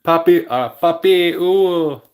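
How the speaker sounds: comic Spanish accent on Papi Uwu, stretched and high. Shown as a demonstration. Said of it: "say it in a Spanish accent".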